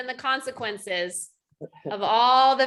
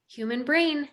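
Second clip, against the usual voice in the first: normal talking voice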